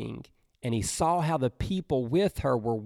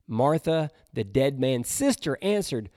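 ordinary talk, nothing marked

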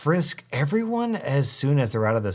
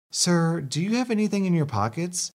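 lightly voiced higher official woman; polite check at the scanner, voiced a little higher; customs lady; 6 semitones higher than usual. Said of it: "says the customs lady".

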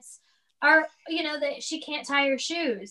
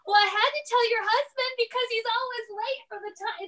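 imitating mom; 10 semitones higher than usual. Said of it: "she was like".

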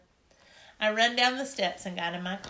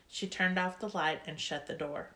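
usual speaking voice

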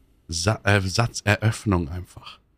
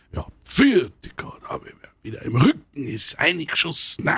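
grumbling Bavarian dad; 5 semitones higher than usual. Retold or imitated. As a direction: imitate a complaining Bavarian father. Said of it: "der Vater von meiner Freundin". (jammernder bairischer Vater)

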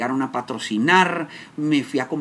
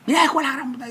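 much higher mock angry yell; father's curse; 11 semitones higher, faster than usual. Father cursing at his grown son. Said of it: "me dice".

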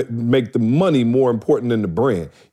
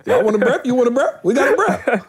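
much higher mock voice of the brother; 12 semitones higher than usual. Imitating his brother teasing about the shirt, much higher and laughing. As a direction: poke fun at his brother's whining, pitched way up. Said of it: "who was like".

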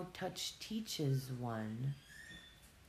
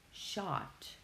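normal talking voice

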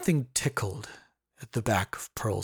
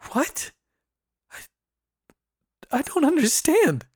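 1 stunned; 5 dB louder than usual. A narrator's reading. Shocked girl after attack.

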